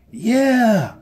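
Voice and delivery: high, loud cheer; excited high froglet cheer; shout. A narrator's reading. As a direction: shout yes all together. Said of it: "shouted the froglets".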